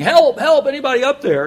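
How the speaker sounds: high, very loud cry for help up a cliff; desperate yell for help. Retold or imitated. Jack hanging off a cliff, yelling upward for help. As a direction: shout up from the cliff edge, panicked. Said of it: "he starts yelling".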